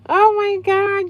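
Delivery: high, loud, slow mock shock; mock gasp. Reacting to a troll comment with mock shock. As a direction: gasp in fake outrage.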